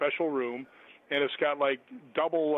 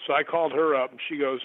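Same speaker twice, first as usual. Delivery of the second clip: ordinary delivery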